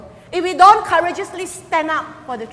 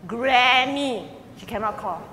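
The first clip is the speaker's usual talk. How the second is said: normal talking voice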